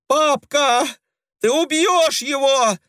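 high desperate shriek; desperate wailing. A narrator's reading. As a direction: wail in desperate panic. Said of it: "отчаянно голосила Клавдия".

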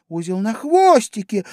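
put-on high, eager girl's exclamation; exclaiming. A narrator's reading. Alice misunderstands the mouse, exclaiming eagerly. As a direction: cry out in a high voice. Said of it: "воскликнула Алиса".

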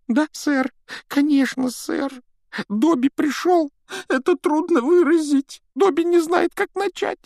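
very high, squeaky elf voice; eager little house-elf, squeaking. A narrator's reading.

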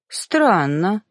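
slow, amused smirk on the word; a hint of a knowing smirk. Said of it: "хмыкнул он".

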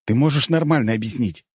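understated severity; stern. A narrator's reading. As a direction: demand an answer with some severity. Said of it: "сурово сказал я".

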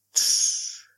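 whispered hush to calm her. Acted. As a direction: gently shush a crying girl. Hushing the crying girl.

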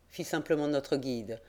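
ordinary delivery, nothing marked

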